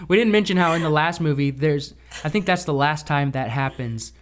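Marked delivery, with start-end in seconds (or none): none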